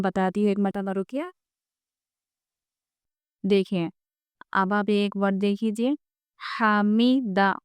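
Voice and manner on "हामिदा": हामिदा said slowly with even weight; correct even reading. Shown as a demonstration. Teacher modelling correct हामिदा to pupils. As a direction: slowly sound out हामिदा, every syllable equal. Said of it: "बराबर आप हम वज़न इसको पढ़ेंगे".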